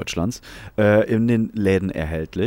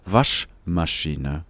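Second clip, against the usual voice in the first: slow dramatic reveal; 4 semitones lower, much slower than usual. Pretend. Presents obvious word as revelation.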